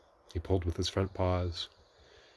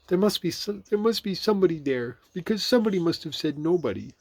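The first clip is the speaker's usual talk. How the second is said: high, louder bear voice; puzzling Pooh; 11 semitones higher, 6 dB louder than usual. A narrator's reading. Pooh thinking to himself outside the hole, puzzling. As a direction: reason aloud in a high character voice.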